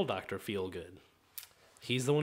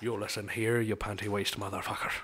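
put-on Irish accent; 7 semitones lower, 5 dB quieter than usual. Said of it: "that's my Irishman".

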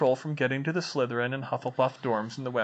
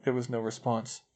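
plain reading voice

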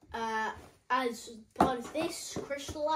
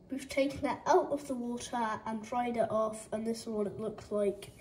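normal talking voice

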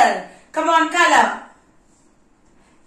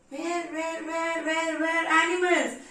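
hushed searching; 7 dB quieter than usual. Pretend. Searching for animals in jungle.

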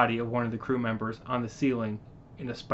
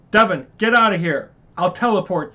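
urgent order; 5 semitones higher, 7 dB louder than usual. Acted.

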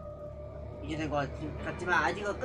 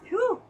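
sharp high relief huff; high relieved sigh; 6 dB louder than usual. Real, not acted. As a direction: blow out relief. Relief after killing enemy. (안도의 높은 한숨)

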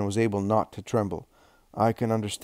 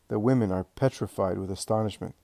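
ordinary delivery, nothing marked

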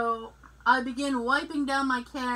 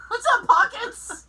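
laughing; 10 semitones higher, 6 dB louder than usual. Real, not acted. Teasing nickname greeting.

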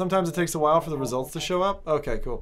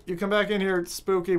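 ordinary talk

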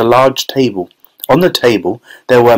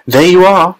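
high, triumphant exclamation; triumphant cry; 7 semitones higher, 4 dB louder than usual. A narrator's reading. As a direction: cry it out as proof you were right. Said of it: "he cried triumphantly".

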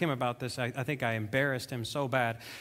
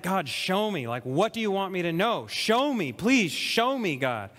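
urgent, higher-pitched plea to God; pleading prayer; 3 semitones higher than usual. Retold or imitated. Man praying as he searches the Bible, pleading. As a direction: beg to be shown, drawing out the last please. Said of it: "I'm kind of praying at the same time".

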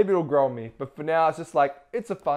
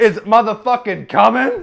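louder, higher hype voice; hype announcer; 7 semitones higher, 13 dB louder than usual. Acted. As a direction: build up the big reveal. Hyping the reveal.

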